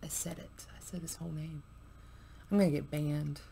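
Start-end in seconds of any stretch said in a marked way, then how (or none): 0.0-1.6 whispered, as if someone might overhear; nervous whisper. Real, not acted. Vlogger fearing a ban after saying the name, whispering. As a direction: breathe it out in a guilty whisper. Daring to say Trump's full name.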